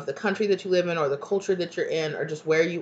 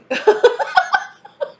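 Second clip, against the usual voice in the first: high, loud burst of real laughter; helpless laugh; 8 dB louder than usual. Real, not acted. Reader amused by imagined drag queen, cracking up. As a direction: crack up at your own joke. Cracking up at her own imagery.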